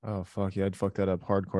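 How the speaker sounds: ordinary delivery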